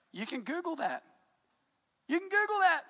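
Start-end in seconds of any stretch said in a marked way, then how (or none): 2.1-2.8 emphatic shout. Real, not acted. Repeats line, second time shouted.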